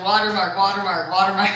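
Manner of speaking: "Watermark" said loud, each falling; mock him by shouting watermark back. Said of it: "I said".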